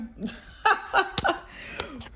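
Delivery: breathy high giggle; embarrassed laugh. Real, not acted. Reader laughing off a wardrobe slip to viewers. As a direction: laugh it off sheepishly.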